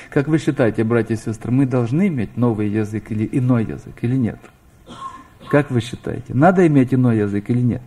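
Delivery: ordinary delivery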